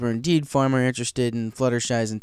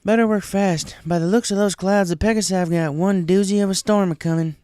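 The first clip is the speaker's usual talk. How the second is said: higher and louder country warning; warning Applejack; 8 semitones higher, 5 dB louder than usual. A narrator's reading. Applejack warns Fluttershy of the storm, higher and louder. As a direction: urge them on with farm-girl grit. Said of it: "A J warned".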